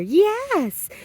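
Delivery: high yes to the dog. Real, not acted. Praising the dog.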